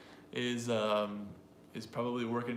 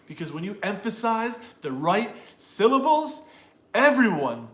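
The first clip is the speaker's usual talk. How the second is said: loud, high punches on "emphasize", "right", "syllables"; forceful speechmaker stressing each key word; dramatic emphasis; 7 semitones higher, 12 dB louder than usual. Shown as a demonstration. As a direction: belt out "everyone" like a rousing speech. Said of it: "you don't have to be so dramatic or aggressive".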